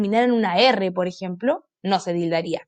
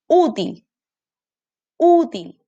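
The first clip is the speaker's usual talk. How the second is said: "útil" with a held, raised Ú; stress on first syllable; 4 semitones higher, 4 dB louder, much slower than usual. Shown as a demonstration. Teacher helps children hear the tonic syllable, "útil" stressed on Ú. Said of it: "Aquí está su sílaba tónica".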